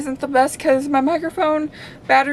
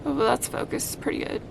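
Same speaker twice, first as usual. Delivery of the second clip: whispered aside; 8 dB quieter than usual. Real, not acted. Aside about camera focus.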